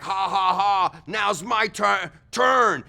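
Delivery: loud, slow mock villain laugh; gloating villain. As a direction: cackle it like a cartoon bad guy. Kim Jong-un villain on shark.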